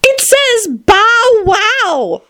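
very high, drawn-out squeaky flower voice; crying-out daisy. Acted. Daisy calling out its joke, high squeal. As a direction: squeal it slowly as a tiny daisy. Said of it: "cried a daisy".

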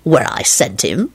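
low, slow insolent reply; lazy low cheek, lifting at the end. A narrator's reading. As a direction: take your time, insolently unbothered. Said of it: "he replied insolently".

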